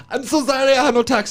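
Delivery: high-pitched imitation of an apologetic driver; apologetic young driver. Young man pulled over by guards, mock high apology.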